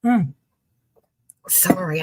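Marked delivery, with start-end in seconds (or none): 0.0-0.3 humming while slurping coffee. Real, not acted. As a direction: hum through a noisy sip of coffee. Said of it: "I'm slurping my coffee".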